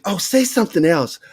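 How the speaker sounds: high-pitched mock delight; master sergeant's wife. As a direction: imitate an eager, charmed wife. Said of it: "She's like".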